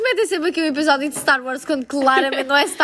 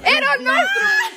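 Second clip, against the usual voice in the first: much higher, loud triumphant cry; excited shout; 5 dB louder than usual. Real, not acted. Right answer revealed, outburst.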